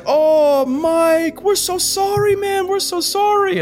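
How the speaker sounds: broken English policemen. Retold or imitated. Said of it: "and they're like".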